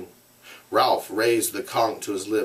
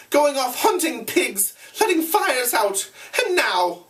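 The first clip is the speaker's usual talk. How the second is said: put-on high, indignant scolding boy. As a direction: scold them like a fussy boy. Piggy scolds the assembly.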